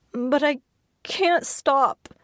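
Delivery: high, tearful and breaking; barely getting it out through tears; crying. Acted. Patient in tears unable to stop.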